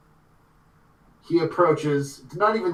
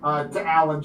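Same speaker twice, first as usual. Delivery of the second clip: ordinary delivery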